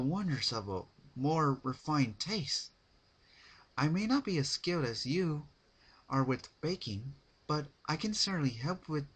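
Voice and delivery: lightly voiced refined pony declining; polite Rarity voice. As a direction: decline sweetly, a touch posh. Rarity lecturing on taste.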